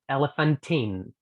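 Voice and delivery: "Elephantine" as one slow word, t onto "in"; slow linked. Shown as a demonstration. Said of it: "Y voy a unirlos".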